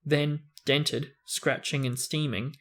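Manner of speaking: usual speaking voice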